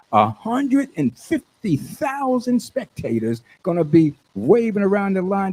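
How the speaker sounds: ordinary talk, nothing marked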